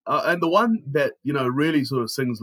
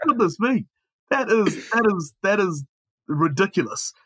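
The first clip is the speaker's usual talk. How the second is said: hammed-up shocked exclamation, pitched up; mock astonishment; 7 semitones higher than usual. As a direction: play it overly stunned. Hammed-up shock at episode seven.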